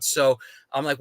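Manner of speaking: ordinary talk, nothing marked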